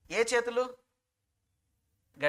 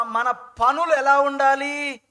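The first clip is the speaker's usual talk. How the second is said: loud slow question; 5 semitones higher, 9 dB louder, much slower than usual. Real, not acted. Preacher building to climax.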